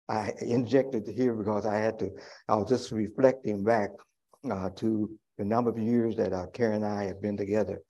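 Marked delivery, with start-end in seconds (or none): none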